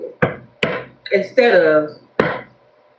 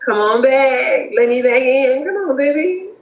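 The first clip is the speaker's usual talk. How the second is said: pleading ex; 7 semitones higher, slower than usual. Retold or imitated. Voicing a pleading ex.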